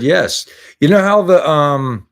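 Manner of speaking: normal talking voice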